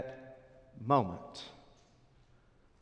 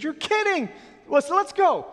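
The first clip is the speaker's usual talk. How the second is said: very high and loud, ending in a shout; stunned then urgent; 9 dB louder than usual. Acted. Peter and John hearing the news, shocked shout. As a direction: disbelief turning into a dash. Disciples react, rush off.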